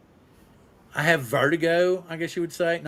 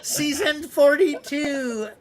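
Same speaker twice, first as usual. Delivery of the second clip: put-on high, creaky old show host, slowing at the end; an elderly announcer opening a show; old man host; 8 semitones higher, 4 dB louder than usual. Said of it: "Wait, let me put my teeth in".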